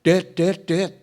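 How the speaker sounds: high, loud English-style call; child calling out. Retold or imitated. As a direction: shout for dad like a kid. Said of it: "anak saya agak Inggris-Inggrisan". (anak berteriak memanggil Dad)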